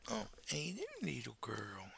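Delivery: hushed sweet greeting; soft pet coaxing. Real, not acted. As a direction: coo gently to the puppy. Coaxing the dog on camera.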